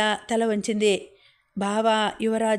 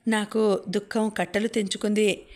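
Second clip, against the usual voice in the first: ordinary delivery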